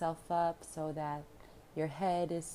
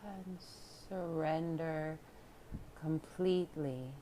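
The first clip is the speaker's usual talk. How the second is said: hushed, spacious relaxation guidance; guided meditation; 6 dB quieter than usual. Real, not acted. Yoga teacher inviting listeners to surrender, calm meditation voice. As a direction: let each phrase settle into a long pause.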